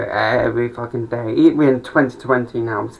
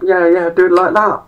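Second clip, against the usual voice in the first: mock coach cheering; 6 semitones higher, 6 dB louder than usual. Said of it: "It's like".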